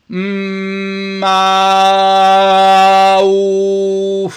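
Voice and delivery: high, very loud sustained "mm-ah-ooh"; hum, then open into one long ringing note; resonant vocal exercise. Shown as a demonstration. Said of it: "Airflow and body resonance".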